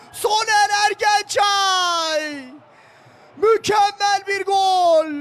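high, loud, drawn-out goal scream; screaming football commentator. Real, not acted. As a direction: stretch every word of the goal call. Second goal call.

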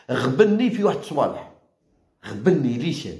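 normal talking voice

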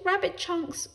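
high-pitched dismayed wail. A narrator's reading. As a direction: wail the label aloud. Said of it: "she wailed".

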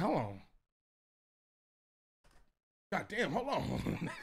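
soft, slowing, woozy mutter; steady yourself as the liquor hits; dazed realization. Acted. Drunk man back in his room, dazed realization.

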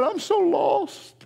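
high, slow, aching plea; a lost son near tears, drawn out; son's broken plea. Retold or imitated. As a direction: let the words crack with grief. Son breaking down in restaurant.

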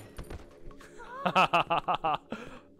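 loud, high staccato laugh; nervous burst of loud laughter; startled laugh. Real, not acted. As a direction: laugh loudly from the shock. Reaction to sudden game enemy.

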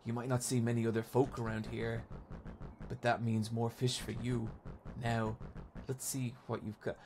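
soft, folksy accent with a hint of menace; quiet, faintly sinister lake local; underplay a shady bait-shop man; vaguely sinister shopkeeper. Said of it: "vaguely sinister tone from this dude".

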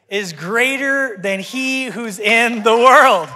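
slow, building to a shout; loud, drawn-out triumphant proclamation; preaching climax. Real, not acted. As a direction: stretch it out and belt the ending.

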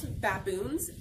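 usual speaking voice

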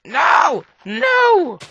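loud, high "No!"; real frustrated cry at the screen; shout. Real, not acted. Shouting at game mishap.

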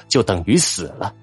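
plain reading voice

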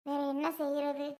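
helpless tearful murmur; tearful lament. Acted. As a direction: grandmother lamenting to her son, tearful. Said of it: "திருப்பி ஒப்பாரி வைக்க ஆரம்பிச்சிட்டியா".

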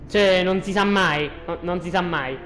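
ordinary talk, nothing marked

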